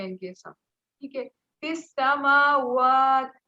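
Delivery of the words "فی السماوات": فی السماوات slow and loud, stopping on the final ت; recited pause form. Shown as a demonstration. Teacher recites the pause form for the student, slow and loud. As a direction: recite it and stop dead at the end. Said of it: "وقف ہو گیا نا".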